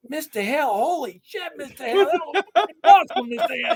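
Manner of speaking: put-on high, gushing kid voice; excited students. Students rave to teacher after seeing him on TV, high and thrilled. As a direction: gush like starstruck teens. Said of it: "They're like".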